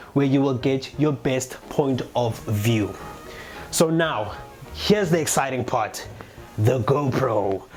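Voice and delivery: normal talking voice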